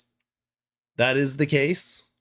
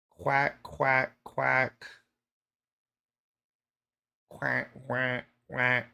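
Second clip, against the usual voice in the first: quacking; 5 dB quieter, much slower than usual. Pretend. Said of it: "you kinda need to quack but talk".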